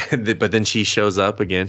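normal talking voice

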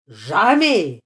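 one loud shouted refusal; old man's loud, obstinate shout; defiant cry. A narrator's reading. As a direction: yell it stubbornly, full voice. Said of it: "cria Clodomir".

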